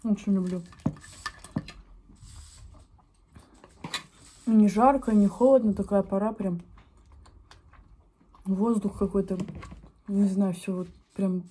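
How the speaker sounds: words muffled by chewing; talking while chewing. Real, not acted. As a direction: mouth partly full of food. Seen 2: Bites food at -4, then cheeks move and lips stay pursed throughout.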